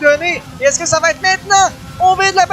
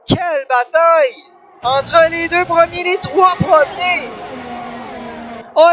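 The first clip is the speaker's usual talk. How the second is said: motorsport commentator; 3 semitones higher than usual. Real, not acted. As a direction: call the battle at full voice. Live play-by-play final laps. (commentateur sportif survolté)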